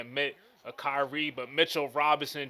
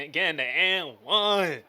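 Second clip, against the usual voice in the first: slow, loud drawn-out call; hyped and-one call; excited call; 4 semitones higher, 7 dB louder, slower than usual. Real, not acted. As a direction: stretch it out like a big highlight call.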